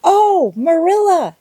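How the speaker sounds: high, loud, breathless cry of delight; breathless exclamation. Acted. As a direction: burst in gasping with excitement. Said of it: "she exclaimed breathlessly".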